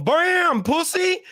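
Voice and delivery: high exclaimed impact and jeer; gleeful mock taunt. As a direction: crow it like a comic knockout. Acts out the knockdown, jeering.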